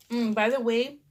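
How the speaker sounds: ordinary talk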